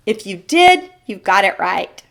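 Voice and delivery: usual speaking voice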